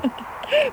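high, wild giggle; high giddy burst of laughter. Acted. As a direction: laugh with reckless delight. Anna throws shoes overboard.

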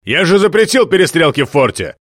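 loud, angry command; furious leader. Acted. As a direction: berate them from above.

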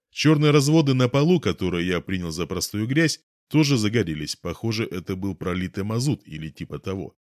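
ordinary delivery, nothing marked

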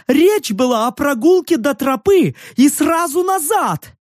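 высокий визгливый крик девчонки; орёт, возмущённо наезжая; злой крик. Acted. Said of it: "вскочила и заорала". (высокий злой ор)

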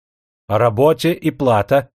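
usual speaking voice

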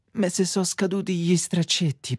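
normal talking voice

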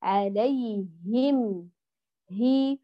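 "عليهم" very slow with audible h and i, then "هي"; long, careful "عليهم", distinct hi; clear articulated haa. Shown as a demonstration. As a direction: bring out the h in "عليهم هي". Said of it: "انتبهي لمخرج الحرف وحركته".

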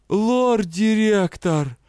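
high, loud, stretched-out cry of shock; shocked exclamation. A narrator's reading. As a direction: gasp out the title in disbelief. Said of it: "потрясённо воскликнул".

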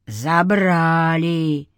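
very slow, drawn-out sinister repeat; undead echo. A narrator's reading. An undead spirit echoing the man's accusation, sinister. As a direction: echo it back slowly as a dark spirit.

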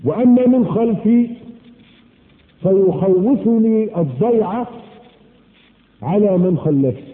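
drawn-out, weighty quotation; slow preacher's quotation; slow solemn quote. Acted. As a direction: lay each phrase down slowly. Quoting Shaqiq slowly.

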